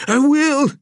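high, loud frantic yes; tortured woman frantically agreeing to talk; frantic agreement. Acted. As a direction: blurt it out in terror. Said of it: "Gessner frantically agreed".

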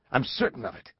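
firm, certain insistence; challenging. Acted. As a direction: insist on it firmly. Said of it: "Langdon challenged".

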